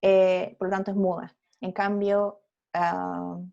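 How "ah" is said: ordinary delivery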